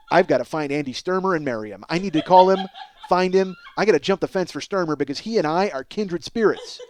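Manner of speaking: ordinary talk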